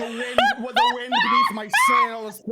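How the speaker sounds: high, loud, hammed-up adoration; mock swooning shout. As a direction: belt out the drunken devotion. Reenacting drunken declarations of love.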